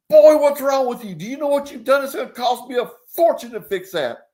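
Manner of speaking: high, loud furious outburst; a man bellowing over his damaged Jaguar, furious; angry yell. Acted. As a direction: yell at the kid who dented your car. Executive yells at kid over dent.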